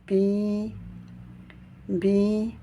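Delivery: BI with a long, held I; prolonged I. Shown as a demonstration. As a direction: stretch the vowel of Bi over two beats. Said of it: "du son I prolongé en deux temps".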